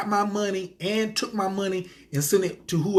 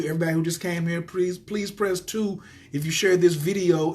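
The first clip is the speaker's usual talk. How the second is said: ordinary talk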